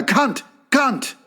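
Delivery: high grunted insult, drawn out; caveman grunt. Retold or imitated. Tribesmen warning off an invader, high grunting. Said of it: "look at him and go".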